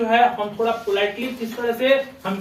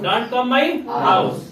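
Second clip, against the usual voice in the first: slow model sentence; much slower than usual. Shown as a demonstration. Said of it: "teacher models sentence".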